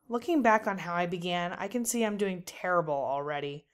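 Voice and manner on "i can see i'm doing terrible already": ordinary delivery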